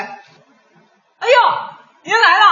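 high, loud eager waiter welcome; loud waiter greeting. Acted. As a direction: greet the diner like a hearty waiter. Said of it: "我演饭店的服务员".

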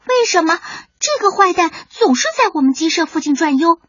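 high little-chick voice; puzzled youngster's high-pitched question; puzzled child. Acted. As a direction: ask Mum, honestly baffled. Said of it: "卡门不解地问妈妈".

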